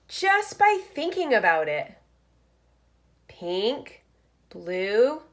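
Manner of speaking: lively picture-book read-aloud; reading to children. Acted. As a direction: read it like story time for first graders. Reads picture book aloud to class.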